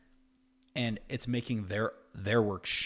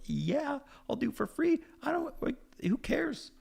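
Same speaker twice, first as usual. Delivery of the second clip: much higher, squeaky, eager voice; eager reply; 14 semitones higher than usual. Retold or imitated. Agreeing to work for free, high eager squeak. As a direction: squeal it like you can't wait.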